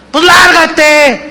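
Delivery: high, slow, angry snap; enojado. Acted. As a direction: spit it out at the wife in anger. Marido enojado corre a su esposa. (esposo enojado corre a su esposa)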